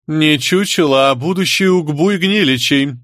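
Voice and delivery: high, deliberate scoffing correction. A narrator's reading. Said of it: "хмыкнула маманя".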